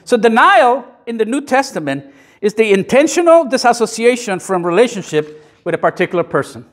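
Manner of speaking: usual speaking voice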